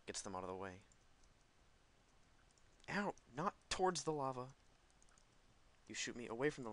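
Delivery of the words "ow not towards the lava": alarmed yelp. Real, not acted. Knocked back toward lava.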